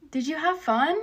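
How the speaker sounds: soft, falsely sweet retold question; a light, smiling taunt. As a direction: ask it softly with a smile. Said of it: "look at him with a smile".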